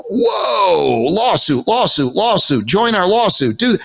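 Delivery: high, drawn-out whoa, then sing-song repeats; mock alarm. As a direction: act out being stunned by a pile-up of bad news. Acted alarm at Google search results.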